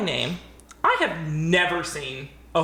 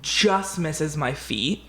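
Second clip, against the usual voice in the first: ordinary delivery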